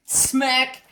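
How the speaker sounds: high loud smack sound; mock smack while retelling the fight; imitated smack. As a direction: spit out the hit noise. Hitting the dog.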